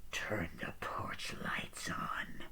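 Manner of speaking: slow, soft whispered threat; creepy killer whisper; menacing whisper. Retold or imitated. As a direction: breathe it slowly, barely voiced. Killer's menacing phone order.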